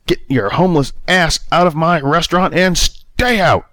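high, contemptuous angry shout; throwing her out. A narrator's reading. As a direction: drive her out the door with it. Manager throwing Bea out.

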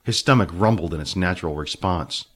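plain reading voice

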